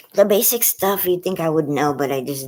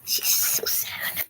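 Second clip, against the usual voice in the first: slow, breathy whisper; whispered pity; 5 dB quieter, much slower than usual. Real, not acted. Owner whispering pity for her sad dog. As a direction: murmur it with sympathy.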